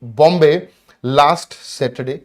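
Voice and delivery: very slow, word by word reading; teacher dictating the sentence for the class; slow dictation. Shown as a demonstration. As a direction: read out the full question slowly. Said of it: "चलिए, तो".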